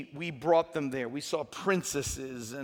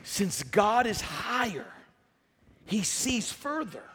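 deliberate statement; 5 semitones higher, slower than usual. Real, not acted. First statement of sermon point.

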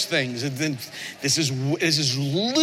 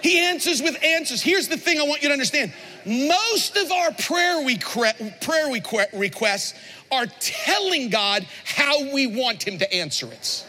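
slow, high, shouted preaching; preaching at full force; 8 semitones higher than usual. Acted. Pastor hammering the point to his church, shouting. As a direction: drive it home to the congregation.

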